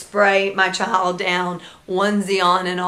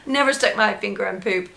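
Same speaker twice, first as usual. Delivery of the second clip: normal talking voice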